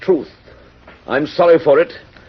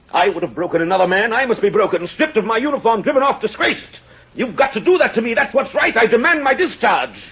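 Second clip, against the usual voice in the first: impassioned demand; 9 semitones higher than usual. Acted. Javert demanding the mayor dismiss him, impassioned and high. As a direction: demand to be broken, rising.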